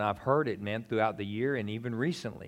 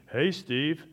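loud, booming call from above; a mock heavenly voice calling his name; booming voice of God; 5 semitones higher, 6 dB louder than usual. Shown as a demonstration. Preacher mocks God booming at Steve, loud call. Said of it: "I don't mean".

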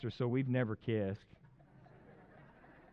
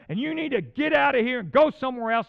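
high, loud, furious retold rant; 11 semitones higher, 11 dB louder than usual. Young counselor venting anger at his dorm floor, yelling. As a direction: tear into the guys on the floor, shouting. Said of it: "I was ranting".

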